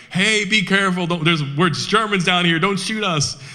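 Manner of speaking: high-pitched retold pleading yell; mock panicked yell. German prisoners begging Americans not to shoot, high mock yell. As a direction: squeal it out like prisoners pinned under fire. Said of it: "The Germans are yelling".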